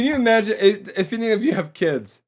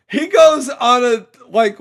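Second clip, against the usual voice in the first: ordinary talk, nothing marked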